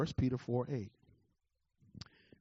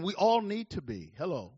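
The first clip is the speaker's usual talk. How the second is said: normal talking voice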